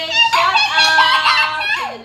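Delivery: squealed high, loud and slow; laughing shout out. Real, not acted. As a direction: shriek it out through a laugh.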